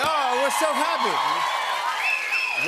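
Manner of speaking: high, slow gushing delight. Real, not acted. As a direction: swoon with joy.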